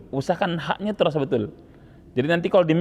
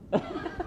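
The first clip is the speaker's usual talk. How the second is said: short high laugh; 8 dB quieter than usual. Real, not acted. Teacher laughs at student.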